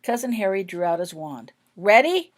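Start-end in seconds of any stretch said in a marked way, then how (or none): 1.8-2.3 "Ready?" called loud and high with a rise; loud question. A narrator's reading. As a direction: check the team is set over the noise. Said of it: "he asked the Weasleys".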